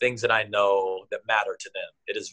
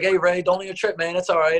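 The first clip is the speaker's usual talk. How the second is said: higher, mock-friendly needling; sarcastic reassurance; 9 semitones higher, 4 dB louder than usual. Coach teasing Ray about showing up late, sarcastic. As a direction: fake comfort that really stings. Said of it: "I was like".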